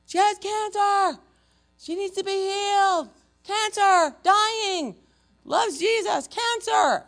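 very high, loud mock shouting; imitated yelling. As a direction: bellow each demand like a frantic crowd. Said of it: "they're all yelling at me".

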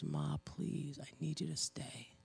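hushed, imitated plea to a mother; a small, quiet retold plea; begging child. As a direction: softly beg as a child would. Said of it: "her child to be begging".

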